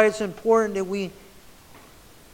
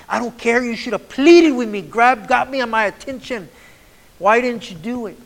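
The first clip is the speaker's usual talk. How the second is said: raised pleading; 5 semitones higher, 9 dB louder than usual. Acted. Voicing a pleading speaker.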